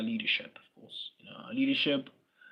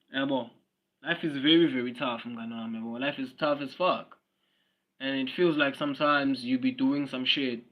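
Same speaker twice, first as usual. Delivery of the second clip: ordinary talk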